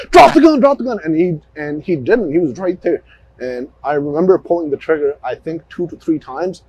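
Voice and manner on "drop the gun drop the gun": high, loud, urgent police yell; retold shouted command. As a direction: shout the order twice, easing slightly. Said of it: "immediately I drew down. I was like".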